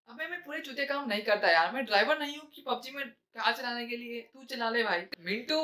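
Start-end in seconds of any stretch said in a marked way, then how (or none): none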